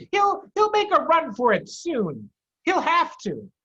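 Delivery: high, loud smug farmer voice; farmer Bean. Acted. Bean tells Boggis the fox will bolt, smug. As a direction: certain the fox must run. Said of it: "Bean told him".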